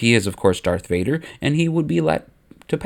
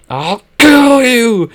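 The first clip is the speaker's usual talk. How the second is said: high, loud, stretched-out scream; 11 semitones higher, 8 dB louder, much slower than usual. A narrator's reading. Vader screams at the Emperor as he charges in. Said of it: "Vader screams as he charges".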